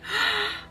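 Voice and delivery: high breathy intake of air; sharp starstruck gasp. Retold or imitated. Fan gasping as [name] walks out. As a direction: gasp like you've just seen her. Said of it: "and I'm like".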